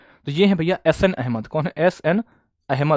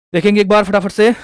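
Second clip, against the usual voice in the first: higher, louder call to the class; 5 semitones higher, 8 dB louder than usual. Real, not acted. Teacher calling on his online class, loud and higher. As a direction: call out to wake the students up.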